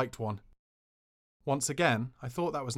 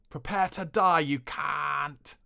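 loud, high mock-menacing shout at the doorway; prank shout; 3 semitones higher, 5 dB louder, much slower than usual. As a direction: yell it to scare a sleeping friend. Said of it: "shouted at the top of my voice".

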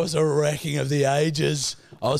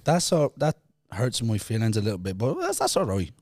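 playful Irish brogue; mock Irish accent. Feigning hurt feelings in mock Irish accent. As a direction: protest in a put-on Irish accent. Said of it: "I'm, I am Irish".

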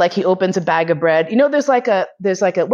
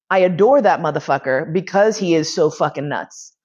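usual speaking voice

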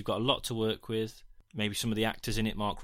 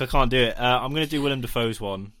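normal talking voice

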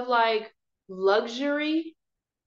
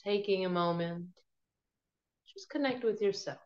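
guided meditation; 5 dB quieter than usual. Acted. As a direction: ease them gently into calm.

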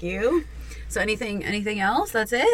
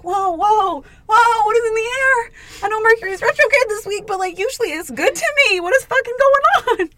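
mock bewildered; 12 semitones higher, 3 dB louder than usual. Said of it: "And I'm like".

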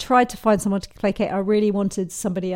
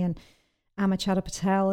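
usual speaking voice

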